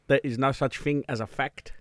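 put-on slow South African lecturer accent. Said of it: "South African".

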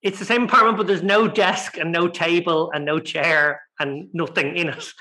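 ordinary delivery

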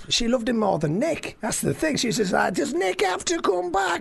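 high, gleeful Scouse retelling; Scouse accent. Acted. Comic reporting girlfriend's verdict on his alter ego, high Scouse accent. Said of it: "What did your girlfriend make of Jerry".

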